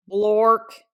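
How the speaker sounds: a sudden loud nonsense blurt; loud silly word. Acted. Reader forced to say the book's silly word, blurting.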